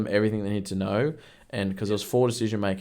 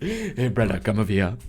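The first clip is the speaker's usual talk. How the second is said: put-on lightly done Jamaican accent. Agent mocking his own slip, Jamaican accent. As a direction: redo the botched Jamaican accent for laughs. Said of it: "that was a Jamaican accent".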